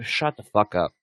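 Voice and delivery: ordinary talk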